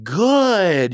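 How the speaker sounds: high, loud, stretched GOOD; exuberant praise. Retold or imitated. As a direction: burst out GOOD high and long for the dog. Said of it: "is now it's just like".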